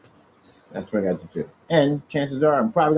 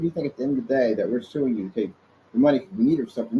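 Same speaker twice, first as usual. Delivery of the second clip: ordinary talk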